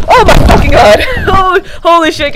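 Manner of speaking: loud, high shocked yell; real jolt of alarm; startled outburst. Real, not acted. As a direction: shout after knocking the mic. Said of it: "guys, sorry".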